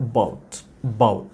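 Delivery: "about" with a faint uh and punched bout; stress on BOUT. Shown as a demonstration. Teacher models primary stress on the second syllable, low and soft. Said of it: "বয়ের উপর আমরা stress দিচ্ছি".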